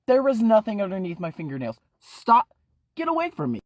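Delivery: high, loud and fast denial; panicked yell. Acted. Ephraim yelling at Shelly about worms, panicked shout. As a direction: scream him away.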